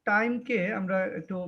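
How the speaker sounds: ordinary talk, nothing marked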